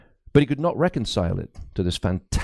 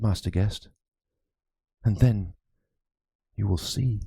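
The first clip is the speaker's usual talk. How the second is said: lightly voiced anxious old priest; a worried cleric confiding a ghost story; frightened priest. Priest confides his fears to Crispin, lightly voiced and uneasy.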